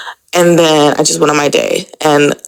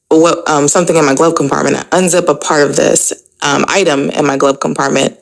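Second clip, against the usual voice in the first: normal talking voice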